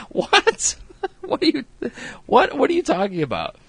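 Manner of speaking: high, laughing disbelief; incredulous. Retold or imitated. Vlogger echoing the friends' baffled reaction, laughing. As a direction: squeak out a baffled what. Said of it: "It's like".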